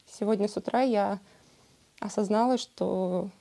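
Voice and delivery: ordinary talk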